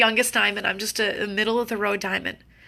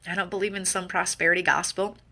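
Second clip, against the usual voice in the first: ordinary delivery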